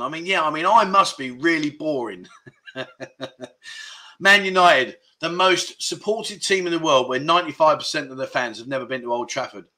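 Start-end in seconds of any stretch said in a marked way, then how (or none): 2.5-3.9 soft, real chuckle; low little run of laughter; self-amused chuckle. Real, not acted. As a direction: laugh quietly at your own remark. Laughs at own self-mocking remark.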